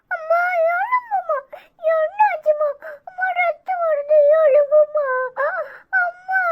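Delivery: put-on high, tearful child's pleading wail; little child crying over his mother. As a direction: sob and beg mother to wake up like a small child. Child wakes fallen bleeding mother.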